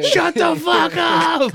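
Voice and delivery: high, drawn-out screechy shout; cats yelling. Acted. Cats fed up with owner's chatter, drawn-out shouting. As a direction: stretch it out like an exasperated cat. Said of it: "my cats meow at me".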